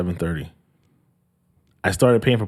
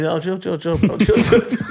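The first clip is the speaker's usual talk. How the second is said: high, rushed chill chill; calm him fast, pitched up; nervous backing down; 6 semitones higher than usual. Retold or imitated. Teller backing down from the tow guy, high nervous pleading.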